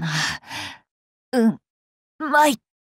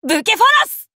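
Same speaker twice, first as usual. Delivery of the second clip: 宝具の叫び; 8 semitones higher, 4 dB louder, much faster than usual. Acted. Noble phantasm chant climax.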